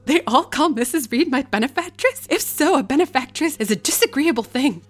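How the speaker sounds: incredulous repeat of a galling word; shrieking indignation, high and loud; indignant disbelief. Acted. Jane's outraged inner voice.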